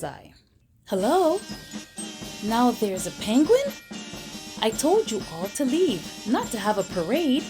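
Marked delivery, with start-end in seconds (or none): none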